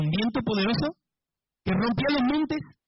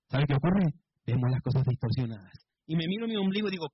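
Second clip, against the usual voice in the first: ordinary delivery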